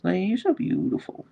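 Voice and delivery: high tender praise; cooing. Real, not acted. Owner cooing at annoyed husky Leia, high and tender. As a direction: coo it sweetly.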